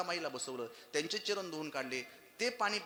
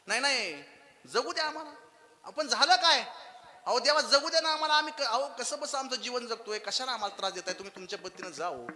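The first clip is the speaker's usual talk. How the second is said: pleading mother; 6 semitones higher, 5 dB louder than usual. Acted.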